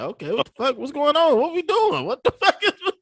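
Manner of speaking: a baffled "what is going on"; hammed-up bewildered questions; confused disbelief. Retelling his confusion at being called back.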